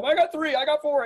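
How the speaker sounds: calling out. Retold or imitated. Everyone calling out seats.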